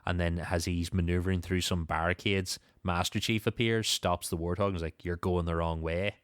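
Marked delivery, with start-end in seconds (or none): none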